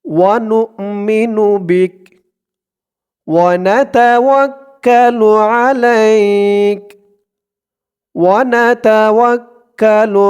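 measured teaching recitation; slow devotional recitation. Shown as a demonstration. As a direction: recite each phrase slowly for repetition. Recitation continues.

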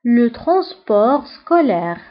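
Le transport scolaire at a slow, deliberate pace; slow careful French. Shown as a demonstration. Teacher slowly modelling school vocabulary. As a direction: spell it out slowly with your voice. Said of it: "النقل المدرسي".

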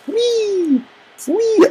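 a very high, joyful squeal; gleeful whee. Real, not acted. Sliding down the broken staircase, squealing with delight.

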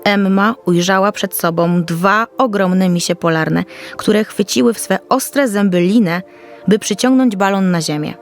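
ordinary delivery

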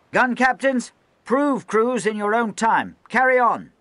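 high, loud stern speech; rally and warn the men at once; loud harangue. Acted. Lieutenant addressing the gun crews, high loud harangue. Officer giving orders.

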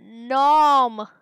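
loud, drawn-out "nom"; booming munching noise; big cartoon chomp. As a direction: swell into a huge playful bite. Eating a cell in game.

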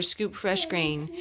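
ordinary delivery

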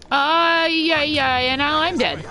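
mock dying wail. Real, not acted. Dying in game, mock lament.

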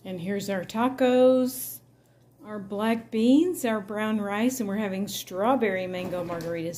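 excited reveal. Real, not acted. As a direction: show off the plate like a prize. Proudly showing off finished dinner.

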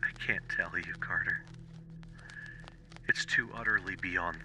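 quiet, despairing voice; hoarse with fear. Acted. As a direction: barely get the words out in dread. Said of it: "still hoarse with fear, and now apparently tinged with despair".

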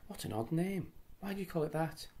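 high, wondering question; curious wonder. Acted. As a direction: ask with bright curiosity. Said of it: "said Beth in wonder".

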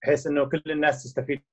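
ordinary delivery

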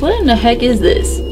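usual speaking voice

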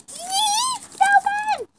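very high, loud shriek of his name; fan shrieking. Acted. A fan spots Logan and shrieks his name. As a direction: scream the name like a starstruck fan.

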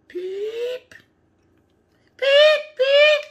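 very high, loud chirpy beeps; high bird beeps. Pretend. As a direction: peep like a tiny baby bird. Baby bird voiced in story.

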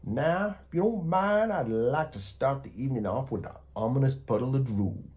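high, loud, theatrical monster announcement; hammed-up monster relishing his menace; grand monster voice. A narrator's reading. Gabe announces his drool to the child, high loud hammed-up monster voice.

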